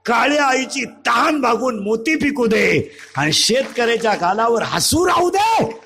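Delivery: ringing invocation, drawn-out endings; declaimed prayer. Real, not acted. Preacher asking the goddess to bless farmers, ringing and declamatory. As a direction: proclaim the blessing to a big crowd.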